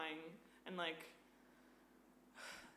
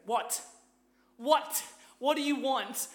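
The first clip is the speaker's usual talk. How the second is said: high, loud what; loud confrontation; 7 semitones higher, 12 dB louder, slower than usual. Retold or imitated. Woman confronting staring man on subway, loud challenge. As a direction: yell it at a stranger on the subway. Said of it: "I just was like".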